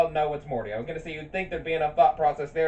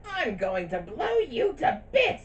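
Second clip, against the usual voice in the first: villain voice; 9 semitones higher, slower than usual. Retold or imitated. Quoting Sir Pentious threat.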